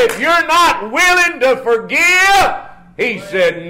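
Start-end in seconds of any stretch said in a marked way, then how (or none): 0.1-2.5 rising preaching. Acted. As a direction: build up and call it out to the pews. Preaching at full force.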